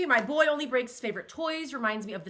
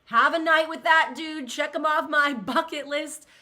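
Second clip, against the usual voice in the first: swooning fantasy; 5 semitones higher, 7 dB louder than usual. Retold or imitated. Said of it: "people that you're like".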